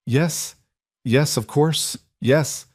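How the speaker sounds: overwhelmed, barely managing the words; tearful yes. A narrator's reading. Karen accepting Ryan's proposal while crying, tearful and overjoyed. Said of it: "Finally, she managed".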